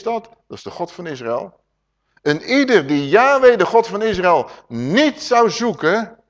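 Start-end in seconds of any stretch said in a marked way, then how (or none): 2.2-6.2 loud, high, slow on niet zou zoeken; thundering sermon read; emphatic preaching. Acted. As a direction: hammer the verse home from the pulpit. Rereads the verse with full force.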